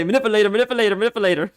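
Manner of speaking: high, loud, rapid repeated Manipulator; imitating his friend. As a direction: mimic a friend blurting it in a panic. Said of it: "that's how T X used to say it".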